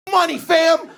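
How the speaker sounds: high, mock street salesman; a high, cocky clerk's "What, fam?". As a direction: pitch it up as a pushy clerk. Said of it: "He goes".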